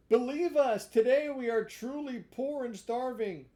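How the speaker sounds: high, loud whining plea; pleading fox voice. Acted. Fox pleading with Pinocchio, high loud whine. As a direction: whine for pity like a sly fox.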